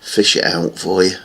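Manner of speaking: normal talking voice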